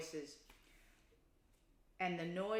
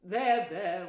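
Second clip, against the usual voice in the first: calm the crying one; kindly; 11 dB louder than usual. Acted. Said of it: "went on the Badger more kindly".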